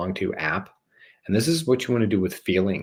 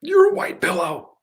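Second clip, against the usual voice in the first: much higher, squeaky silly voice; 5 dB louder than usual. Pretend. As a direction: squeak it like a cartoon.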